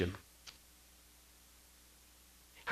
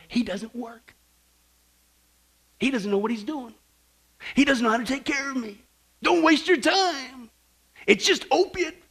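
mock complaint; 8 semitones higher than usual. Preacher translates the hypocritical message for listeners, mock complaint. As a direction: act out a believer's grumbling, building higher. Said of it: "Can I translate that for you?".

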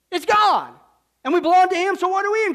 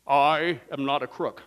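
lying politician; 12 semitones lower, slower than usual. Retold or imitated. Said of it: "statements like this from the government".

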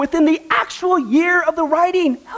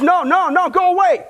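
raised, higher-pitched mock shout of refusal; mock shout at the boat; 6 semitones higher, 3 dB louder than usual. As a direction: call out to wave the boat away, a bit hammed-up. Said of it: "Kenny shouted out".

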